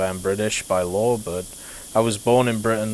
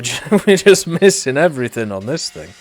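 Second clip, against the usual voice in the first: louder and higher, laughing disbelief; half-laughing outburst; amused exclamation; 6 semitones higher, 8 dB louder than usual. Real, not acted. Amused at missing parts.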